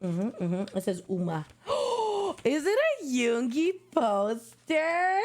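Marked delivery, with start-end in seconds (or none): 1.7-5.2 gasp, then slow, higher hopeful question; excited gasp. Real, not acted. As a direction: gasp and ask in disbelief. Spots poster while unboxing.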